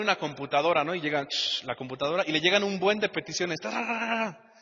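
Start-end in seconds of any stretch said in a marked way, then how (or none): 3.6-4.5 typing noise. Retold or imitated. Computer receiving petitions.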